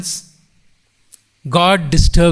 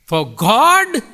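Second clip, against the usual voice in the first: God raised high and drawn out; stress on God; 4 semitones higher, 3 dB louder than usual. Shown as a demonstration. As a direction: lean hard on the word "God". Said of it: "put the emphasis on every single word".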